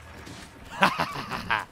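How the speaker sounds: rapid staccato cackle; laughter. Real, not acted. Player cursing then laughing at the game, laughter. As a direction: laugh out in long, rattling runs.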